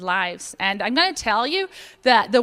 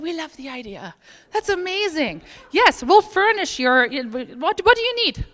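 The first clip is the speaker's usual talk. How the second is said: imitating the supplier's excited yes, going higher on the final question; light mock delight, rising to a high "what do you need"; a playful retold burst of enthusiasm; eager yes; 6 semitones higher, 4 dB louder than usual.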